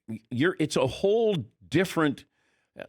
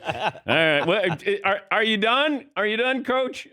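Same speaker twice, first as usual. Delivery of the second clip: rising, higher-pitched needling of a coach; mock patient player; 8 semitones higher than usual. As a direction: humour the ranting coach with a laugh underneath. Mock player waiting out yelling coach.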